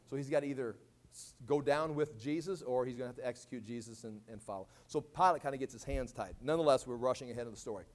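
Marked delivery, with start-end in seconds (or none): none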